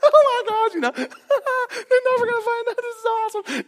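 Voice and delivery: very high, giddy squeal with a laugh; gleeful high-pitched rush of excitement; thrilled kid. Retold or imitated. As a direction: squeal like a kid getting away with it. Kids joyriding thrilled over speed bumps.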